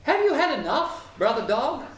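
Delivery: high, lilting sparrow question; sparrow's voice. A narrator's reading. Sparrow checking on brother dog, high and lilting. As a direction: chirp it up high, solicitous. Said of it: "the sparrow asked".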